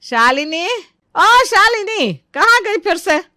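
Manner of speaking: high-pitched call, ओ pushed up. Acted. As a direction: shout her name through the house. Searching house, calling daughter-in-law. (ऊँचे सुर में पुकारना)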